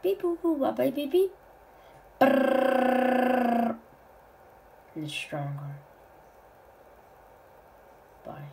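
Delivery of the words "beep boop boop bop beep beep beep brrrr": robot beeps. Pretend. Suit powering up noises.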